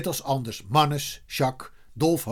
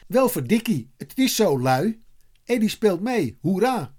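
high, jubilant exclamations; excited shouting; 6 semitones higher, 4 dB louder than usual. A narrator's reading. Kees sees Eddy in kit, shouting with delight. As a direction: cheer the news out. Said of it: "schreeuwde Kees".

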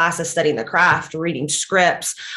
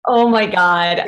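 laughing; 4 dB louder, slower than usual. Real, not acted. Laughs at the husband's movie question.

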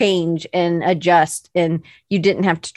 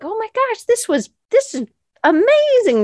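much higher, gushing oh my gosh; excited gush; 14 semitones higher than usual. Retold or imitated. Host recalling first hearing the story, high excited gush. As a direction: squeal with delight at a great story. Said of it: "but I was like".

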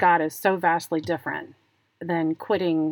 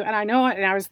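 ordinary talk, nothing marked

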